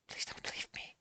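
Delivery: breathless whisper, barely any voice; whispered plea. A narrator's reading. The heroine clinging to him, whispering her plea. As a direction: whisper it like you're begging him to stay. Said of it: "she says".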